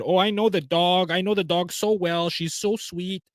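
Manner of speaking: sending up her breezy defence of the dog; mocking Taylor Lorenz. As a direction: lift into a mock airy reporter voice. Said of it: "who came out and said".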